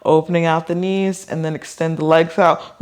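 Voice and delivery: ordinary delivery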